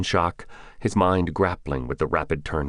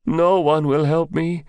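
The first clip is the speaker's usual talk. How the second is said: put-on distressed young man; 12 semitones higher, 6 dB louder than usual. Victim pleads after Taliban attack.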